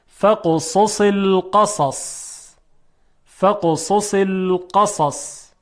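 careful recitation of فقصص القصص, ending on a vowelless ص; teacher's measured فقصص القصص; skilful sad articulation. Shown as a demonstration. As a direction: slowly model فقصص القصص, all four ص distinct. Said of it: "چهار تا صاد رو باید با مهارت کافی تلفظ کنیم".

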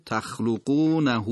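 تخلقونه slow, with the final ha vowel drawn out; long ishba vowel on تخلقونه; lengthened final vowel. Shown as a demonstration. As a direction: stretch the end of تخلقونه.

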